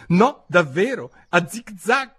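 high, careful insistence; fussy, exact little-girl correction; prissy precision. A narrator's reading. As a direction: spell it out primly in a high voice. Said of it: "disse Alice precisina come sempre".

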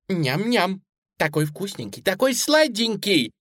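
lightly voiced delighted child; little girl savouring. A narrator's reading. Suzy savours the bunny.

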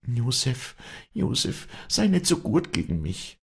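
high, agitated plea; trembling outcry. A narrator's reading. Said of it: "bebte Wally auf".

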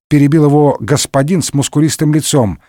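plain reading voice

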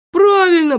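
high, loud exclamation; sudden high burst of agreement; eager agreement. Acted. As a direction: jump in with keen approval. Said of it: "подхватил Незнайка".

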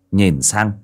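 ordinary delivery, nothing marked

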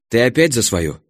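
ordinary delivery

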